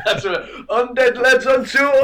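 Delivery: high, loud laughing delight; cracking up while talking. Real, not acted. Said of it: "laughing at the bit".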